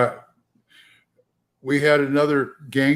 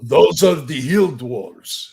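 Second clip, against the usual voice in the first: slow, louder mock Russian accent; Russian dwarf; 7 dB louder, much slower than usual. Player voicing a Russian hill dwarf, slow Russian accent. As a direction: stretch it out in a heavy Russian voice. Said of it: "I'll play a Russian one".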